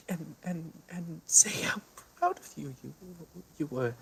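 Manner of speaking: sobbing, self-hating, whispering; tearful anguished outburst; crying. Acted. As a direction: break down crying and curse yourself. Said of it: "she starts crying".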